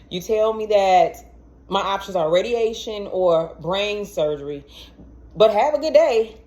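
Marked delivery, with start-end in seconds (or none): 5.3-6.4 louder, mocking "have a good day"; sarcastic. Real, not acted. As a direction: toss it off with bitter irony. Sarcastic summary of doctor's call.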